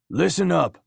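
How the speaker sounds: high, urgent yell for attention. A narrator's reading. Milton to his crew over the microphone, yelling. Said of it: "Milton yelled into his microphone".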